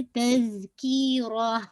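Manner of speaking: तज़कीरा drawn out, ending on a full, heavy rah; heavy raa. Shown as a demonstration. Teacher models the word for students, slow recitation. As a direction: stretch तज़कीरा slowly and make the last raa heavy. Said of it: "रॉ को आपने heavy करना".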